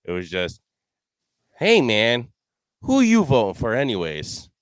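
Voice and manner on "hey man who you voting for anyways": a higher, louder put-on buddy voice; imitating the teammate asking out of nowhere; mock teammate. Golf buddy retold asking about the vote, mock casual.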